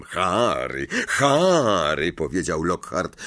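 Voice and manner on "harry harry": drawn-out name, louder the second time; impatient chiding. A narrator's reading. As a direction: chide him, second call rising. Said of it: "kręcąc niecierpliwie głową".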